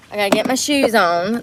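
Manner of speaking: usual speaking voice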